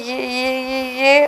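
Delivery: hushed, halting repeated word; faltering stammer. A narrator's reading. Haemin seeing the dead in his dream, faltering softly. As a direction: trail into a soft, frightened stutter.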